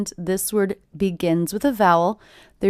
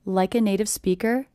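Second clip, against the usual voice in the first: "Like a native speaker" lifting on "speaker"; continuing rise on the end of "speaker". Shown as a demonstration. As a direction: let "speaker" climb, as if unfinished. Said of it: "my voice goes up at the end".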